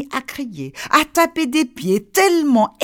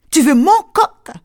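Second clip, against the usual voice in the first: outraged disbelief; 5 semitones higher, 7 dB louder than usual. Acted. Outraged neighbour.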